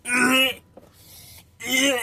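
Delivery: high-pitched mock retch; imitated retching. Cellmate disgusted by the smell, about to vomit, gagging. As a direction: gag as if about to throw up. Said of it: "he's about to throw up".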